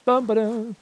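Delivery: ordinary delivery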